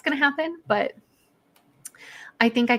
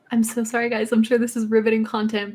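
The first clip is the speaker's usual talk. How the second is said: usual speaking voice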